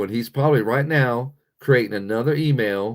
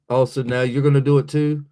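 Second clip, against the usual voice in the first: ordinary delivery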